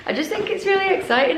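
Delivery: bright, lifted excitement; gushing over the box; excited. Real, not acted. Excited about the shoe box.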